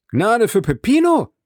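high, loud demanding cry; alarmed cry. Acted. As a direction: shout it up high, startled out of stupor. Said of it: "rief Andrea, völlig aus der Starrheit erwachend". (hoch und laut, aufgeschreckt gerufen)

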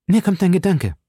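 put-on high, excited young musketeer; D'Artagnan. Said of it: "sprach D'Artagnan". (hohe, aufgeregte Stimme eines jungen Musketiers)

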